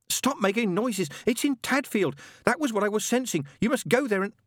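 high, insistent warning; frantic urgency. Acted. Angel warns the machine about Tadfield, frantically. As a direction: blurt the message in agitation, pitched high.

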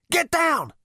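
high loud warning; urgent shout. Acted. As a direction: shout it as it flies past. Robot shoots past.

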